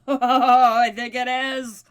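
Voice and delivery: slow, loud, laughing through the words. Real, not acted. Gamer laughing as the build is finally complete. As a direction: chuckle it out with glee.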